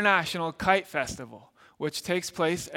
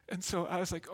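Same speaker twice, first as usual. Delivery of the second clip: normal talking voice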